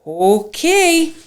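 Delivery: high-pitched, drawn-out okay; acted surprised okay. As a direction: lift it high in mock surprise. Acts out his relieved reply.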